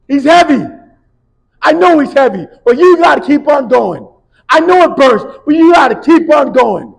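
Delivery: very loud, high shouted push; coach yelling. Retold or imitated. Coach to Brock, loud high yelling. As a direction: drive him forward with a yell.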